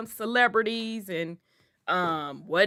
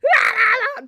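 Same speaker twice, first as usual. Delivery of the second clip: much higher, wailing laugh-cry; auntie's crying shout; 13 semitones higher than usual. Retold or imitated. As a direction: holler it in outraged disbelief. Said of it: "She's like".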